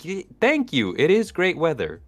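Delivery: high, chirpy mock reply; cheerfully oblivious. Guest mocking own inattention, high oblivious retelling.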